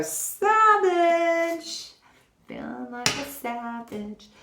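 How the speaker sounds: high, drawn-out announcing of savage; excited card reveal. Real, not acted. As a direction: exclaim the card name with relish.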